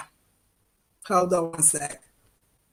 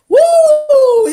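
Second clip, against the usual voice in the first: high, loud "woo!"; preacher's whoop; 12 semitones higher, 8 dB louder than usual. Retold or imitated. Showing the preacher's little dance, an exuberant whoop. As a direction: let out a big church whoop.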